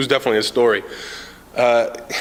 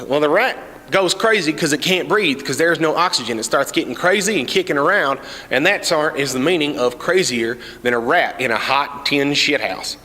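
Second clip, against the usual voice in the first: hillbilly explainer; 4 semitones higher than usual. Retold or imitated. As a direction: spell it out like a good old boy. Cletus finishes explanation.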